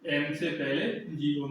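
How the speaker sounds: slow and spaced out, word by word; slow dictation. Shown as a demonstration. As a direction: dictate it slowly so students can write it down. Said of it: "मैं बोल रहा हूँ तो आप लिख भी सकते हैं".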